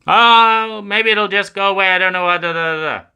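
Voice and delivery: high, loud airy brush-off; hammed-up hopeful denial; dismissive avoidant voice. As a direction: wave the problem away like a nervous child.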